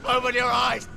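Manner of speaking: high, strained cry; desperate plea. Acted. Begging dying brother to wake.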